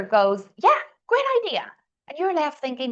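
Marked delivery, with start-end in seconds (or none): 0.6-1.7 very high-pitched, gushing leader; enthusiastic approval. Acted. Leader responding to the next colleague's idea, enthusiastic. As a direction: act out a boss suddenly delighted.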